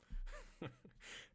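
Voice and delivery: high, airy giggle; amused giggle. Real, not acted. Reader tickled by scribes' funny names, high giggle. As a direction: giggle at a silly name.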